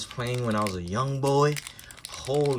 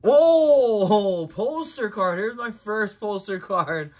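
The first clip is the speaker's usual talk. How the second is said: high excited exclamation, loud at the start; amazed, pitched well up from a loud whoa; real thrilled surprise; 9 semitones higher, 6 dB louder, slower than usual. Real, not acted. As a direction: burst out at a lucky find. Pulls first poster card, thrilled.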